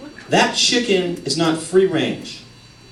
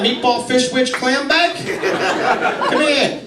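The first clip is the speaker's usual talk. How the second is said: put-on Boston accent; 9 semitones higher than usual. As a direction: bark silly insults in a thick Boston accent. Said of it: "they got the crazy accent".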